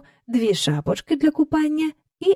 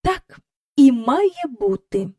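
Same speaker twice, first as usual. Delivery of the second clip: slow, higher-pitched instructive remark; didactic; 4 semitones higher, slower than usual. A narrator's reading. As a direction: state it like a governess lecturing. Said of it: "сказала вона повчально".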